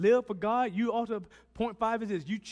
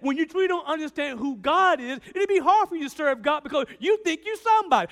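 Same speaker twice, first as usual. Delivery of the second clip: loud, high, driving preacher's voice; full-force preaching; 7 semitones higher, 7 dB louder than usual. Acted. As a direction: preach it hard to the congregation.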